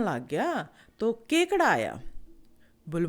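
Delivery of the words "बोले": plain reading voice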